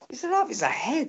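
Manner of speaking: high, excited disbelief. Real, not acted. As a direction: rave about the goal. Raving about stunning goal.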